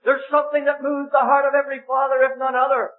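high, impassioned preaching building to a cry at Isaac; full-force preaching. Acted. Preacher telling the meeting of God's gift, impassioned. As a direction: pour out the wonder of the sacrifice.

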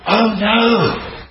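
dramatic oh no. Pretend. Preacher acts out seeing one lie as God does, horrified cry. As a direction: gasp it out as if struck by sin. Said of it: "say something like this dramatically".

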